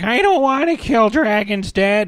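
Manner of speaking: high-pitched whiny son; Hiccup. Retold or imitated. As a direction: whine at dad like a squeaky teen. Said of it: "he's like".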